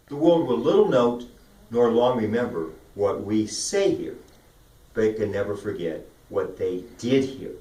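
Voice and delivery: measured oratory with long pauses between phrases; lively articulated recitation. Shown as a demonstration. Coach reciting the Gettysburg Address as a warm-up, measured and emphatic. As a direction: give each word life and its consonants weight. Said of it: "find life in the words and the vibrations in the consonants".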